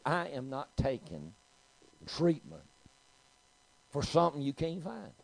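high, word-by-word refusal of treatment; emphatic refusal. Retold or imitated. Patient refusing treatment from doctor, high and emphatic. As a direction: dig in, spacing out each phrase.

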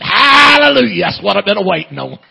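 long ringing shout, easing off at the end; jubilant hallelujah shout. Retold or imitated. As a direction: burst out in revival-style joy. Stepdad joins, acted joyful reply.

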